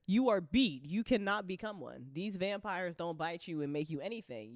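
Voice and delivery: usual speaking voice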